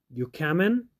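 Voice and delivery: "You coming?" with "are" dropped and the g left off, "ya comin'?"; natural pronunciation. Shown as a demonstration. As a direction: drop the "are" and soften the ending to "comin'". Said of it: "Natural pronunciation".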